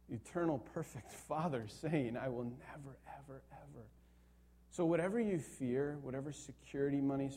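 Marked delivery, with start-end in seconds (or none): 2.1-3.8 soft, fading to almost nothing; hushed promise. Acted. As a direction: let the promise sink to a hush. Voicing God's tender promise, hushed.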